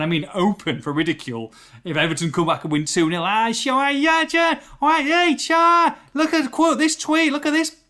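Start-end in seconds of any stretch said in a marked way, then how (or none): 3.2-7.8 high, loud, hammed-up Scouse accent; mock Scouse accent. Mocking fans quoting his tweet, in a high Scouse accent. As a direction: mimic a cheeky Scouse fan in a put-on Scouse voice. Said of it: "What was that accent?".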